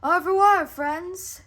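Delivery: high loud farewell; triumphant shout. A narrator's reading. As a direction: fling it back in triumph.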